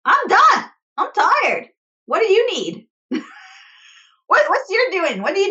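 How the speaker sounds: fed-up partner who has nothing left to give; exasperated rant. Retold or imitated. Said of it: "then you're like".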